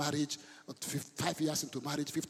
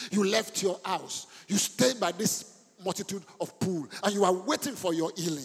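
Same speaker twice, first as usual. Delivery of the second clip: forceful preaching; 4 semitones higher, 8 dB louder than usual. Real, not acted. Preacher challenging the one waiting by the pool, forceful preaching.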